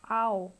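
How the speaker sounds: "ow" as one loud, gliding vowel; diphthong demo. Shown as a demonstration. Teacher shows learners the vowel in house, loud gliding "ow". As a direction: sound out "ow" as in mouth. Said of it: "that is".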